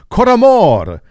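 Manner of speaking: mock Italian accent, loud; playful faux-Italian announcer flourish; hammed-up Italian accent on the festival name. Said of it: "I'm saying that with an Italian accent".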